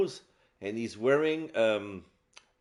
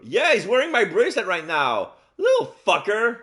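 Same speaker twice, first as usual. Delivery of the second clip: annoyed; 10 semitones higher, 7 dB louder than usual. Real, not acted. Real annoyance at theft.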